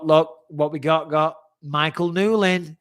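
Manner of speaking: normal talking voice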